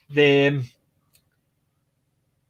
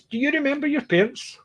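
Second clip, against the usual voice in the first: questioning voice; 10 semitones higher than usual. Retold or imitated.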